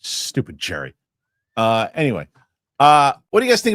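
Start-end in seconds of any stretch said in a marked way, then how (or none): 0.0-0.9 muttered aside. Real, not acted. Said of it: "muttered insult aside".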